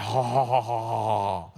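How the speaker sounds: loud, low belly laugh; cracking up at the squirt; real laughter. Real, not acted. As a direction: laugh out at the mess.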